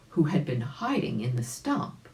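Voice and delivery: ordinary delivery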